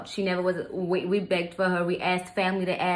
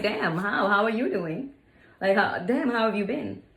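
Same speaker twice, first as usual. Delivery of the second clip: mock small talk. Mock small talk after nine months.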